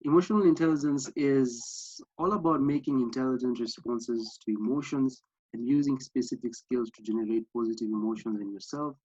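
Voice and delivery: normal talking voice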